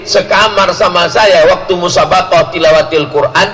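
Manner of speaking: ordinary talk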